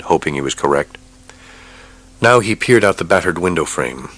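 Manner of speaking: plain reading voice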